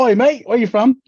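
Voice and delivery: loud, high mock Australian greeting; Australian accent. Drunk man greeting Australians across the casino, hammed-up Australian accent. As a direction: call out cheerily in a put-on Aussie accent. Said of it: "he put on the accent".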